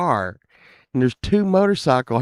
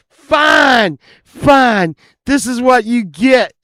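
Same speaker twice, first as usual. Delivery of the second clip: high-pitched retold shout; angry defiance; 7 semitones higher, 4 dB louder, slower than usual. Jilted friend threatening before ramming the car, furious mock shout. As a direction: burst out in mock fury.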